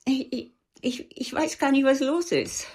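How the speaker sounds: distraught, halting stammer; trembling. Acted. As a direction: struggle to get the words out, bewildered. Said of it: "Die sonst so energische Frau zitterte". (zittrig, verstört stammelnd)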